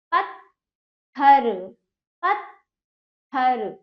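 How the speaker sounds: पत्थर drawn out, long stop after पत्; slowly part पत्थर into पत् and थर; syllables split. Shown as a demonstration. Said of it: "आधा ता".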